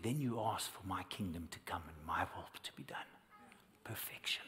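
hushed whisper for the voice of God. Retold or imitated. Recalling God's word to him, in a whisper. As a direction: breathe it, barely voiced. God's words retold to the preacher.

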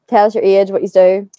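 ordinary delivery

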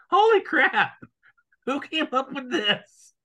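high, loud shocked yell; holy crap, high and loud; shocked outcry. Retold or imitated. Imagined shocked reaction.

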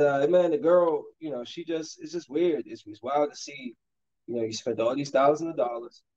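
normal talking voice

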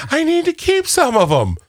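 very high, slow whiny imitation; drawn-out falsetto mockery; mock pleading co-host. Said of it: "you said".

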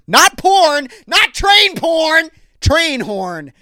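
high, loud, flustered yell; shouted correction. Real, not acted. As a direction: shout down your own slip. Loudly correcting own slip.

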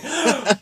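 mock gasp. Dramatic gasp in mock quote.

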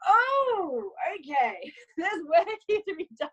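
a high, laughing gasp of dismay at her own photos; amused shock at what needs fixing; laughing surprise. Retold or imitated. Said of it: "I was like".

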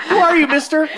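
put-on high, squeaky child asking; little kid voice. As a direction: play a wary little kid. Plays a child to the ice cream man.

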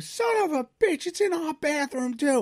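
high, drawn-out mock wail; wife yelling. Wife discovering the bathroom leak, high exasperated holler. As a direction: imitate a wife hollering from another room. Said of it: "I hear my wife".

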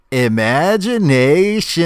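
"imagination" stretched slowly, high and loud; hammed-up, singsong "imagination"; drawn-out wonder. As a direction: sweep grandly through every syllable of "imagination". Imitates the famous drawn-out line.

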